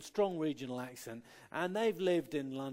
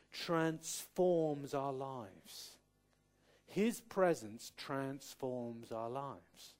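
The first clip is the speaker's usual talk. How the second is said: slow emphatic; much slower than usual. Real, not acted. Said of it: "repeated for emphasis".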